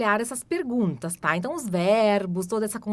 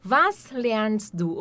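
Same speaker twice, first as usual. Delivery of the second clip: slow demo; much slower than usual. Shown as a demonstration. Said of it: "teacher models question".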